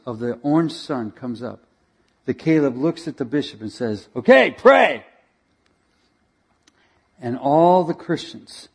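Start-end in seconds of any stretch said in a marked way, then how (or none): 4.1-5.0 higher, louder curt order to pray; commanding order. Retold or imitated. Caleb telling the bishop to pray, curt and loud. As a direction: bark it like a command.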